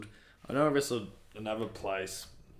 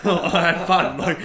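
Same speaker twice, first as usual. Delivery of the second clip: loud, higher, drawn out through laughter; laughing; 7 semitones higher, 14 dB louder, slower than usual. Real, not acted. Wrestler retelling banter with his opponent, laughing. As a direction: crack up while saying it.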